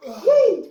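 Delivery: high loud whoop; excited whoop. Real, not acted. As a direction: let out a sharp high whoop. Hot pepper challenge reaction.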